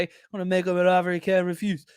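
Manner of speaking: fast, mumbled mafia boss voice; a clumsy stab at the Godfather; bad Godfather impression. To the audience, a botched Godfather line. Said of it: "the worst Godfather impression of all time".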